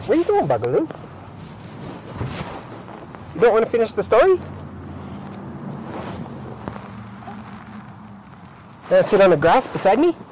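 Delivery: high, fast sing-song coaxing. Real, not acted. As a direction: sweetly coax the little one back. Coaxing toddler mid-story.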